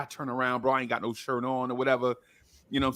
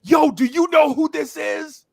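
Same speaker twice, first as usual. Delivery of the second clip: high, loud, slow mock outrage; hyped challenge; 12 semitones higher, 5 dB louder than usual. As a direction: imitate the entourage calling someone out. Acts out friends challenging him.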